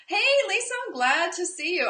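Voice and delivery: bright, high, loud hello to a viewer; excited greeting. Real, not acted. Teacher greeting Lisa in the live chat, excited. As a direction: call out a happy welcome.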